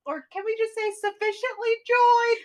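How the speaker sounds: high, loud mock call down a hallway; hammed-up off-mic producer shout. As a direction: yell it like an offstage producer.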